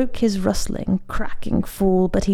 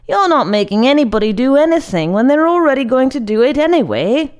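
fast, high, louder protest; indignant objection; 5 semitones higher, 6 dB louder, faster than usual. A narrator's reading. Monk disputes his friend's boast, indignant. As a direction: argue back in a rush, pitched up.

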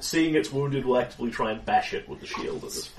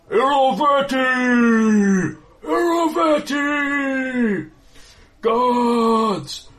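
booming voice; 14 semitones higher, 10 dB louder, much slower than usual. Acted. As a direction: roar it out like a booming giant. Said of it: "booming voice, it booms out".

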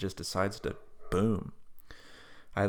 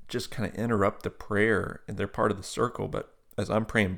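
usual speaking voice

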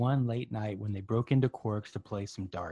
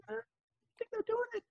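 knowing gossip voice; 8 dB quieter than usual. Retold or imitated. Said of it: "people would be like".

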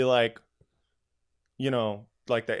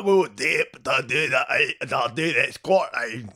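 garbled, badly faked Scottish burr; worst-ever mock Scottish; terrible Scottish accent; slower than usual. Shown as a demonstration. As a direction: fumble through a hopeless Scottish accent. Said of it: "I do have the worst, uh, Scottish accent".

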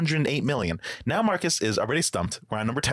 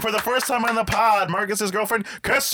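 announcer voice; 8 semitones higher, 5 dB louder than usual. Acted. Game show style guest introduction.

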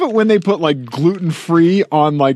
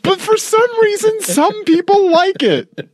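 laughing. Real, not acted. High laughing voice.

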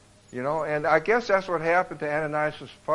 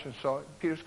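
normal talking voice

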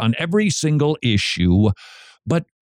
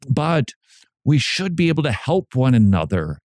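ordinary talk

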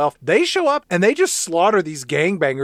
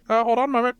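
high put-on receptionist voice; mock operator, pitched up; switchboard operator; 7 semitones higher, much faster than usual. Operator asking the caller to hold, high mock-professional.

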